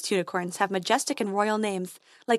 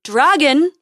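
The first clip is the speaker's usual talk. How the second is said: higher loud call, rising; calling again; 5 semitones higher, 10 dB louder than usual. Acted. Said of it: "he called again".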